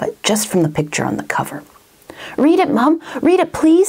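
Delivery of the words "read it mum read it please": put-on high, pleading little boy; eager child voice. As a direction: beg like an excited kid. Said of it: "said Mungo that night".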